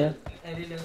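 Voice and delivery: normal talking voice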